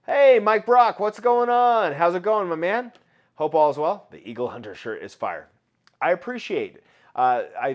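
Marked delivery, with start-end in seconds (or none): 0.1-4.1 high, loud, bright hello to a viewer; big, loud welcome to a viewer; excited greeting. Real, not acted.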